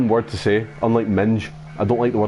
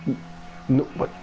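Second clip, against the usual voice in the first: usual speaking voice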